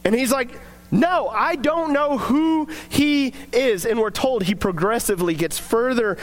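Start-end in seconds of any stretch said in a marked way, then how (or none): 0.9-3.8 slow, drawn-out, higher-pitched mock denial; emphatic denial. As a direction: deny it word by slow word, as a retold quote. Acting Peter's emphatic denial.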